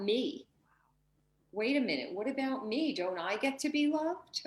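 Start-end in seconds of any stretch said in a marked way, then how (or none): 1.5-4.4 high, plaintive little plea; higher, childlike plea for love; fear's pleading voice. Acted. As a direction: speak as the neglected fearful part. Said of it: "fear is tugging on your sleeve".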